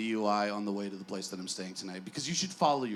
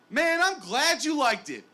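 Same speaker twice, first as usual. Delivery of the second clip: Andre's reply; 8 semitones higher, 6 dB louder than usual. Retold or imitated. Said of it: "He goes".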